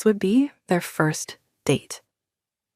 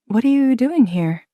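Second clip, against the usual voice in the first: surprised; 6 semitones higher, 4 dB louder than usual. A narrator's reading. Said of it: "Trinity was surprised".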